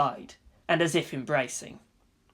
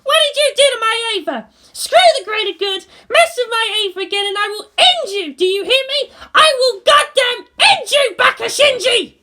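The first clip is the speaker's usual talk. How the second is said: high, louder threats; furious ranting girl; 8 dB louder than usual. A narrator's reading. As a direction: threaten him in rage. Asuka ranting.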